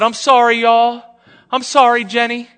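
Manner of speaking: high, loud imitation of a little boy; nine-year-old boy. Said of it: "This little nine-year-old said".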